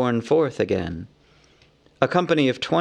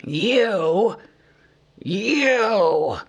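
higher, sputtering cry; angry cry; 9 semitones higher than usual. A narrator's reading. Thorin turns on Bilbo, crying out in fury. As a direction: spit it at him. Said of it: "cried Thorin".

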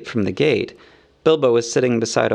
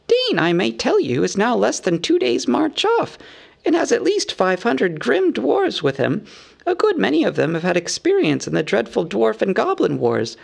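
high-pitched hobbit laying out news; knowing hobbit; 9 semitones higher than usual. A narrator's reading. Bilbo warns of approaching dwarves, knowing. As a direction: inform them pointedly in a high little voice.

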